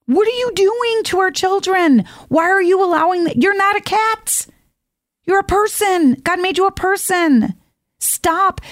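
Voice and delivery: high, incredulous scolding; exasperated outburst. Retold or imitated. Speaker scolding schools over cat kids, high exasperated disbelief. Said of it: "I'm like".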